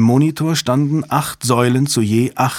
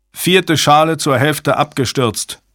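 raised, slow alarm call; 5 semitones higher than usual. A narrator's reading. Technician reporting a failing shield to the team, calling out. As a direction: call the alert across the room, pitched higher. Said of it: "rief ein Techniker".

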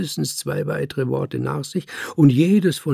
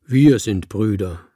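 louder, glad declaration; clear and happy; 6 dB louder than usual. A narrator's reading. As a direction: answer clearly, with a touch of happiness. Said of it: "antwortete klar und war glücklich dabei".

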